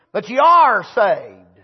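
"are" leaned on, slow and firm; stress on are. Shown as a demonstration. Preacher stressing "are" to the church, slow and firm. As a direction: hit the word "are", drawn out. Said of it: "the emphasis on the word are".

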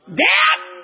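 preaching cry. Acted. Preaching climax.

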